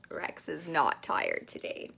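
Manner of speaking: soft low aside; quiet aside. Real, not acted. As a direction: say it under your breath.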